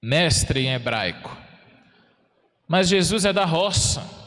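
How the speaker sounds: ordinary talk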